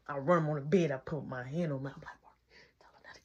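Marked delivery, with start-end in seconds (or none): 2.2-3.2 very soft, hushed order; hushed cover-up; urgent retold whisper. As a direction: whisper a secret instruction. Covering up the prank from nana.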